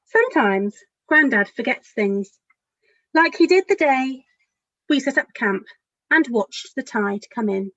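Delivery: gentle picture-book storytelling; lively, caring storybook narration; reading to children. A narrator's reading. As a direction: read it as if to a room of small children. Author reads her picture book aloud.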